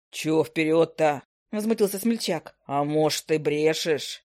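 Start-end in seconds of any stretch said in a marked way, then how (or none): 0.1-1.2 low, slow gruff dwarf voice; indignant gnome. Acted. 2.7-4.2 low gnome voice, suspicious. Acted.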